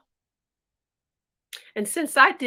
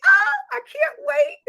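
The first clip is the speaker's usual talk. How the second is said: much higher, louder gushing cry; thrilled squeal; 5 dB louder than usual. Acted. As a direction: squeal it with glee. Milkmaid thrilled about the party.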